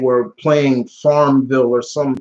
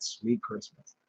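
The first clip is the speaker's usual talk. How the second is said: soft aside; 8 semitones higher, 16 dB quieter, slower than usual. Retold or imitated. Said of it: "I would rather say that".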